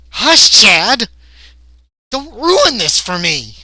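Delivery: high, loud petulant scolding; exasperated scolding. Acted. [name] scolding Chad for spoiling the prank, exasperated. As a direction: snap loudly at a spoilsport partner.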